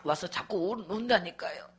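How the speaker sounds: normal talking voice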